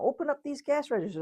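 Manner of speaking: high-pitched demanding outburst, acted; mock scream. Customer blocked at the checkout, mildly screamed demand. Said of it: "You could start screaming".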